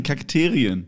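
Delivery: Kakterien drawn out slowly, falling; correcting: Kak-TE-rien, not Kakteen; stress on Kakterien. Shown as a demonstration.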